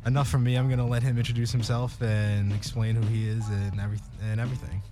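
normal talking voice

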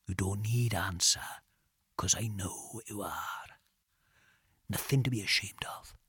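soft, half-whispered needling; very quiet. A narrator's reading. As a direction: murmur it knowingly in the dark. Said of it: "he said very quietly".

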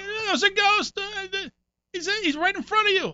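imitating the roommate insisting the ghost is right there; insistent fright. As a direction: push it like he truly saw a ghost.

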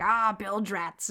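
slow, drawn-out mock pirate growl; imitated pirate. Host mimicking the movie's pirate villain, hammed-up pirate. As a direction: draw it out like a hammy pirate. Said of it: "he is constantly saying".

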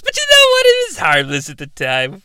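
ordinary talk

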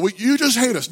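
high-pitched mock accusation; yelling heckler. Heckler accusing the campus preacher, mock angry. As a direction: imitate an angry accuser without real volume. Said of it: "he yelled for a little bit".

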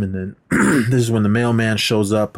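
usual speaking voice

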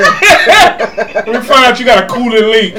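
slow, laughing mock mechanic line. Real, not acted. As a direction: drag it out through laughter.

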